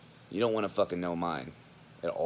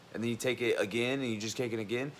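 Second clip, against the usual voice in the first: ordinary talk, nothing marked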